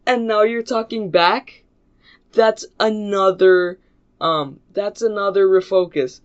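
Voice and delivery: loud, high-pitched scolding; mock angry teacher. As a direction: imitate a teacher piling on punishments.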